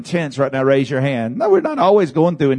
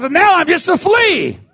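preached cry; 13 semitones higher than usual. Acted. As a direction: call it out to the back row. Shouted then echoed quietly.

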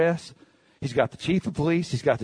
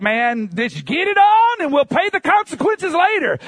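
put-on reckless old self; 11 semitones higher, 5 dB louder than usual. Voicing his reckless old self.

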